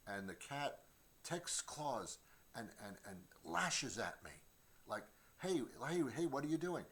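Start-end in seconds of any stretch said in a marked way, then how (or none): 5.4-6.8 high, louder startled hey; startled yelp. Retold or imitated. Scratched by his own cat, he yelps in startled alarm. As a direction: yelp as the claws come out.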